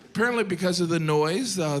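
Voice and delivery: ordinary talk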